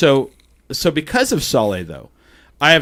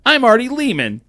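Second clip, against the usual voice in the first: raised pitch, slowing on the rhyme; Artie Lehman; 8 semitones higher than usual. Retold or imitated. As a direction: imitate a showman announcing his own name. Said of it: "he would walk around and go".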